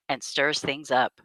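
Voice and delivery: usual speaking voice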